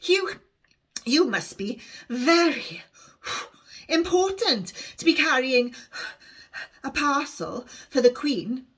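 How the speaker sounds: put-on breathless young girl, a bit slowed; out of puff. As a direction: gasp it out after running to catch up. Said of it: "she is out of puff".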